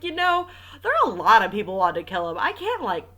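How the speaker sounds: loud, hammed-up exasperated widow; mock indignant protest; mock exasperation. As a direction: imitate her losing patience, loudly. Reader mockingly voices the exasperated widow.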